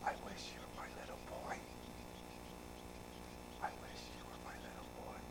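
quick voiceless whisper. Retold or imitated. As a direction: breathe it out as a tender secret. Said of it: "I'm sorry, I whispered".

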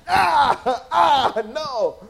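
mock kid's cry. Third grader swatted by Alan.

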